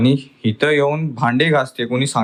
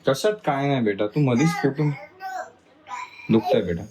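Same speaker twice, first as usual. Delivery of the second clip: normal talking voice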